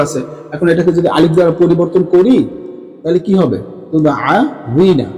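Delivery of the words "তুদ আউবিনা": তুদ আউবিনা drawn out slowly and clearly; slow demo. Shown as a demonstration. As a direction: say তুদ আউবিনা slowly so pupils hear each sound. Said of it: "এই যে দেখো".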